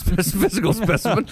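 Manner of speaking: high amused laugh through words; breaking into laughter on the word. Real, not acted. Laughs at the letter's wording.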